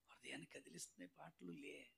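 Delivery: low, very soft reply; hushed aside. Acted. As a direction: murmur it under the worship singing. Hushed chat during worship. (గుసగుసగా చెప్పే మాట)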